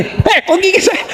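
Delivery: high, drawn-out mock startled cry; mock startled yelp. Preacher acts out mother bitten while breastfeeding, mock startled yelp.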